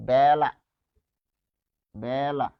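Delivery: বেলা drawn out as "bææ-la"; e pronounced æ. Shown as a demonstration. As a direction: hold the open æ in বেলা, then a short la. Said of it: "তাহলে উচ্চারণটা হচ্ছে বেলা".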